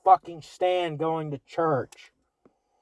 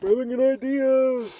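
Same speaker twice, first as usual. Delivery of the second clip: slow, high, loud hammed-up cheer; mock enthusiasm; 9 semitones higher, 6 dB louder than usual. To his co-host, a hammed-up high cheer. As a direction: oversell the brilliant idea.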